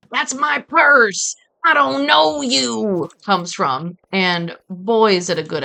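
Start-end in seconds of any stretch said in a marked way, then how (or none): 0.1-1.3 high, loud, drawn-out cartoon yell; Bobby's self-defense yell. Streamer quoting a King of the Hill episode, imitated self-defense yell. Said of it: "that's where that sound". 1.6-3.1 high, drawn-out mock-defensive holler; Bobby's self-defense yell. Streamer quoting Bobby's self-defense class line, imitated high yell. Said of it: "that's where that sound".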